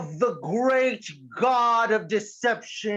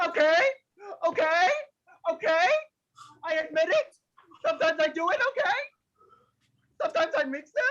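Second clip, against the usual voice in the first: higher, nervous, near tears admitting it; anxious confession; 7 semitones higher, faster than usual. Acted. Player with irrational social anxiety confessing, nervous and near tears. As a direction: crack under the guilt of mixing candy. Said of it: "high levels of irrational social anxiety".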